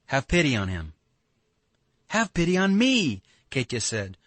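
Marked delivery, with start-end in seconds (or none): 2.1-3.2 very high, louder wail on "me"; bitter reproach. A narrator's reading. Katya near tears answering Alyosha, bitterly reproachful. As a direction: throw it back bitterly, pitched way up on "me". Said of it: "with bitter reproach".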